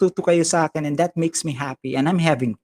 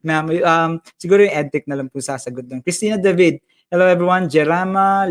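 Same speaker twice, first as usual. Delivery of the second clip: ordinary talk, nothing marked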